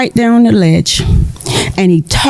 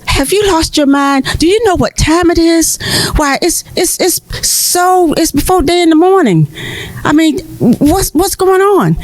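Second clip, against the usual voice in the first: higher, exasperated sleepy farmer; a farmer rousted before day, higher and incredulous; voiced farmer protesting the early knock, pitched higher; indignant farmer; 8 semitones higher than usual. Farmer woken before dawn, indignant.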